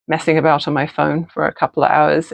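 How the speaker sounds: normal talking voice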